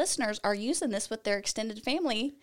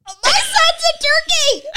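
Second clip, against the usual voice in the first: excited; 9 dB louder, slower than usual. Real, not acted. Delighted outburst at the fact.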